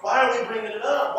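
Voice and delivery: ordinary talk, nothing marked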